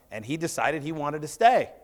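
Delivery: ordinary talk, nothing marked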